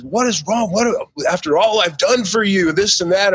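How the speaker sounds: high-pitched mock coach voice; put-on high voice for a protesting coach; imitating upset coach. Coach protests in parking lot.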